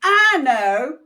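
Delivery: high, loud know-it-all burst; exclaimed. Acted. The know-it-all kid bursting in, high and loud. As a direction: blurt it out proudly. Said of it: "exclaimed one know-it-all".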